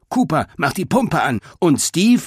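high-pitched brisk orders; commanding. A narrator's reading. Boar Greta assigning jobs to her team, commanding. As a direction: hand out orders, ending on a questioning call. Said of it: "befahl Greta".

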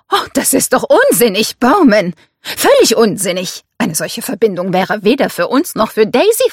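mother dismissing the idea outright; protesting mother, alarmed. Acted. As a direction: object emphatically, pitch lifted.

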